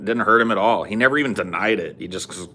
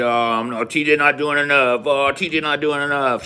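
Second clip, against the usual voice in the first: high, louder whiny mimicry of complainers; mocking whiners; 7 semitones higher, 3 dB louder than usual. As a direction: sneer at the critics in a whiny voice. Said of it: "Heard a lot of people bitching and whining".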